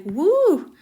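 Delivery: gushing praise. Retold or imitated. Person who pushed the weight issue praising her, gushing loudly. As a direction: cheer her on like an impressed friend. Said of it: "now they're like".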